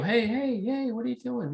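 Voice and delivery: high, alarmed puppeteer voice scolding the doll; startled ventriloquist. Retold or imitated. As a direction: jump up high in shock, like the embarrassed ventriloquist. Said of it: "and they go".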